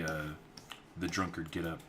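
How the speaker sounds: ordinary delivery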